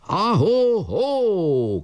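high call and drop; shouted acknowledgement. A narrator's reading. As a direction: call back as you leap. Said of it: "kiáltott Kolnai".

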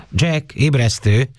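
high, drawn-out wake-up call; understated shout, pitched high and stretched. A narrator's reading. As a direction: call to rouse a sleeper, slowly. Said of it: "kiabálta a lány".

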